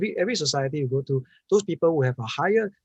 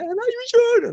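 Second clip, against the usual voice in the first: very high, loud disbelieving squeal; incredulous mother; 6 dB louder, slower than usual. Retold or imitated. Mother doubting Jim's advice, squealing high. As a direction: shriek the doubt like a pampered mother.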